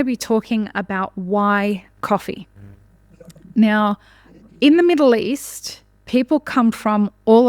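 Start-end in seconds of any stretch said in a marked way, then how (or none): none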